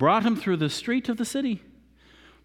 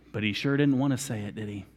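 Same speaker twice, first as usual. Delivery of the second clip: ordinary delivery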